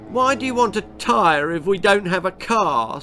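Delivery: high, whiny sulk; sulking baby. A narrator's reading. Baby sulking at Pa about the tyre, whiny. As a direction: sulk the question like a toddler. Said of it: "sulked Baby".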